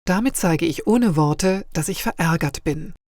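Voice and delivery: ordinary delivery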